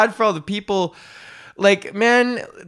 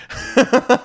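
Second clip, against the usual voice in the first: high burst of laughter; 9 semitones higher than usual. Real, not acted. Laughs at own joke.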